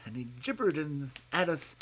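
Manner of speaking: plain reading voice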